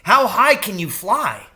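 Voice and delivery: high, loud eager question; little mouse boy bursting with excitement; excited child. A narrator's reading. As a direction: blurt it out thrilled. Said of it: "asked Jeremy in excitement".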